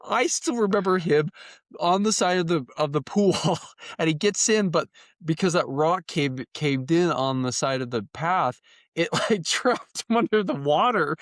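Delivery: high-pitched, struggling through laughter; laughing guiltily. Real, not acted. Host telling how a friend got trapped, laughing helplessly. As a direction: tell it while cracking up for real. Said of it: "I should not be laughing this much".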